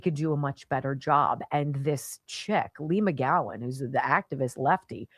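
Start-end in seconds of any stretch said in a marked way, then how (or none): none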